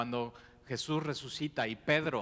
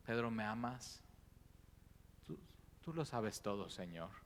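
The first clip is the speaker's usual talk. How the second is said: tender, softer exchange; 10 dB quieter than usual. Acted. Jesus and Peter, tender exchange.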